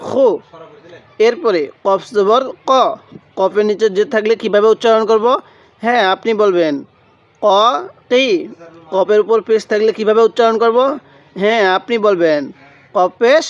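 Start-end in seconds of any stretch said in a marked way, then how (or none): none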